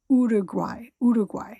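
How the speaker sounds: Uruguay said the Spanish way, G sounded. Shown as a demonstration. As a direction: practise Spanish Uruguay, keeping the G. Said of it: "you're supposed to say the G".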